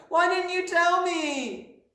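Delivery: very high, drawn-out wail; loud, slow little girl's protest; reproachful cry. A narrator's reading. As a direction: wail it at your teacher, hurt. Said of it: "Matilda cried".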